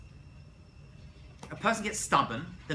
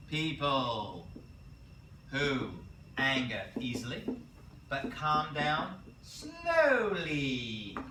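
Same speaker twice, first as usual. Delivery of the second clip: slow, spelled-out dictation pace; dictating so the class can write it down; drawn-out dictation; much slower than usual. Shown as a demonstration. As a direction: stretch out slowly as you dictate it. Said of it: "Write these down".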